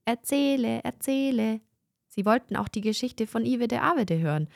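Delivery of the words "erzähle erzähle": put-on children calling. A narrator's reading.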